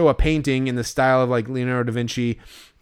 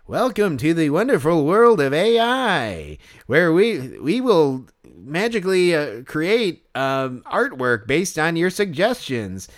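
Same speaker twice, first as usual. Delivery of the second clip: slow, stilted automated announcer voice; canned hot dog host; 3 semitones higher, slower than usual. Acted. Fan imitates the hot dog on the video board, canned and stilted. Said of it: "that's a good impression that you're doing".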